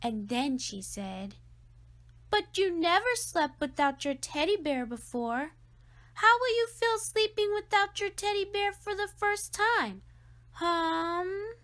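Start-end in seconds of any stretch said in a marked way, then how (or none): 2.3-11.5 high, loud needling voice; big sister taunting, sing-song; teasing sister. A narrator's reading. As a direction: needle him about the teddy bear. Sister teasing about teddy bear.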